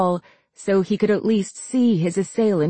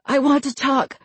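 high, strained plea; hoarse begging; 5 semitones higher than usual. A narrator's reading. Charlie choking in the imposter's grip, begging hoarsely. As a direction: beg through a choked throat. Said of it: "she begged hoarsely".

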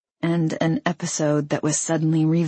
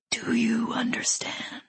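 soft, airy whispered threat; 6 semitones higher, 6 dB quieter than usual. A narrator's reading. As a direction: whisper a warning, eerily gentle. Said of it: "he whispered".

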